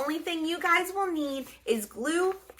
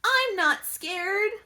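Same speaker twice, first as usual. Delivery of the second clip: high, slow, brave little voice; a tiny mummy insisting he's brave; little baby mummy; 8 semitones higher, slower than usual. Acted. Baby mummy insists he's not scared, slow and high.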